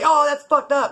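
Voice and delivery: high, loud imitation of a complainer; mock crying complaint. Imitating the man who creeps up on him, loudly griping. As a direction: burst in high with an aggrieved gripe. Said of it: "tired of him crying".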